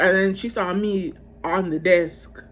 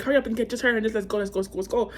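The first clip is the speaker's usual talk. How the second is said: rushed urging; 3 semitones higher, much faster than usual. Retold or imitated. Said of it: "he was like".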